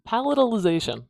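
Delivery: enjoy saying the long word; relishing the word. Real, not acted. Teacher relishing a fun word, excited. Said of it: "That's fun to say".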